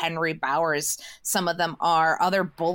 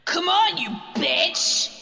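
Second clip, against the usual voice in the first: furious shout; 12 semitones higher than usual. Acted. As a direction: scream it at her in fury. Monster dad screaming at Beverly.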